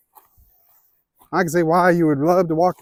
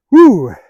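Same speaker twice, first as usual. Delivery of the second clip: winded whew; 5 semitones higher, 13 dB louder than usual. Real, not acted. Out of breath climbing hill.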